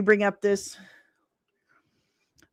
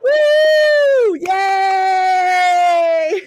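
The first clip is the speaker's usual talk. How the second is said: high, loud whooping cheer; new year cheer; 10 semitones higher, 5 dB louder, much slower than usual. Real, not acted. Cheering as ball drops.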